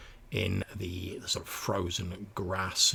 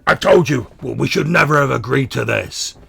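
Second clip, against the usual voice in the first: high, loud accusing shout; angry mutant shouting; 5 semitones higher, 14 dB louder than usual. Acted. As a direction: shout it at your partner in blame. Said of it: "he shouts at the other one".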